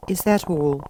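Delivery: put-on high girl's voice, holding back anger; a child swallowing her irritation; Alice. A narrator's reading. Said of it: "swallowing down her anger".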